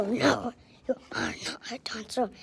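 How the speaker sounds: very low whispered creature voice; evil rabbit voice. Pretend. Kid voicing an evil rabbit to his friend, low whisper. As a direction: growl it low and breathy like a sneaky villain. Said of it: "I'm a evil rabbit".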